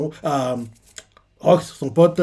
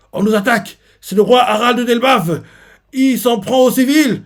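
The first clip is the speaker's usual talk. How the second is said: panicked alarm; 9 semitones higher, 6 dB louder than usual. Retold or imitated. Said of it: "Quelqu'un fait".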